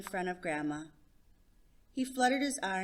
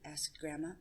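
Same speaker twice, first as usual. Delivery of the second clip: ordinary delivery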